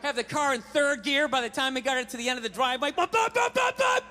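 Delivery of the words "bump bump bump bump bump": much higher bouncing bumps; car bumping noises. Retold or imitated. Car speeding off the driveway, bumping. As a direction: imitate the car jolting.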